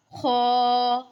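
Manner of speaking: खौ said thick and loud; heavy khaa. Shown as a demonstration. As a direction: give खौ a full heavy sound. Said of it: "इसको मोटा करके अदा करेंगे". (खौ मोटा करके)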